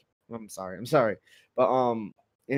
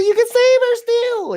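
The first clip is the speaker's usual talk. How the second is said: put-on much higher, loud, pleading girl; desperate high plea; 10 dB louder than usual. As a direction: cry out that there's still hope.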